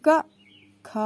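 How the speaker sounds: क short and sharp, then ख held with breath; letter sounds demo. Shown as a demonstration. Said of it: "जैसे अभी हमने आपको बोल के बताया था".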